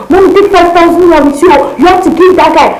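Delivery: high, loud, eager urging; urging mom to tip the guy; eager instruction. Retold or imitated. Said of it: "I was not telling my mom".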